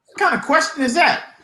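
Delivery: high-pitched, baffled comeback; retold incredulous question. Sergeant answering a soldier's odd question, retold incredulity. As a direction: throw it back in disbelief.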